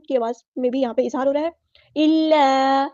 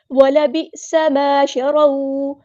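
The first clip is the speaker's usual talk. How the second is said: slow melodic chant, drawn out; Quran recitation; 5 dB louder, much slower than usual. Shown as a demonstration. Teacher models the verse for students, chanting. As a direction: intone the verse like a qari.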